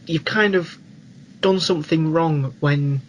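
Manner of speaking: ordinary talk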